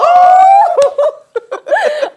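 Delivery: loud, high, helpless laugh; a real shrieking laugh she can't hold back; big burst of laughter. Real, not acted. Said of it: "Let it out".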